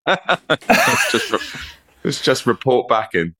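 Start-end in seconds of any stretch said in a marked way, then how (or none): 0.1-1.6 high, slow laugh through words. Real, not acted. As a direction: laugh while speaking. Laughing at own story.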